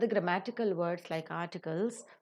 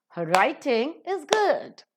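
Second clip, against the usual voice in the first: "writing" and "good" long and higher, "Her" weak; stress on writing, good; 5 semitones higher, 3 dB louder, slower than usual. Shown as a demonstration. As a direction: land heavily on "writing", then "good". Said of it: "is the pronunciation".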